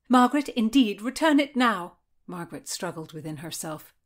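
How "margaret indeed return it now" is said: higher, loud command; urgent demand. A narrator's reading. Anne orders Margaret to return the quill, urgent demand. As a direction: demand it back, loud and sharp.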